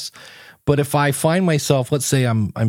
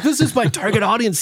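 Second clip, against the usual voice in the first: delighted; 9 semitones higher than usual. Retold or imitated. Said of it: "and I was like".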